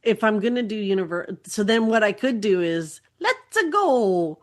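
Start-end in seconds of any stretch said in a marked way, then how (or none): 3.2-4.4 lightly voiced Mario, higher pitch; a hint of Mario, upbeat; Mario impression. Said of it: "that was my best Mario impression".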